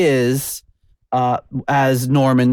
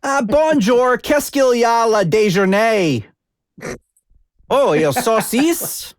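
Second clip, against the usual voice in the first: high, hammed-up Arkansas accent in French; 9 semitones higher than usual. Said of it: "like, Arkansas saying bonjour".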